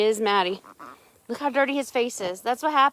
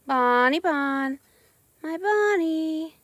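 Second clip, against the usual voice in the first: slow pet coo; 3 semitones higher, much slower than usual. Real, not acted. Coos slowly at her dogs.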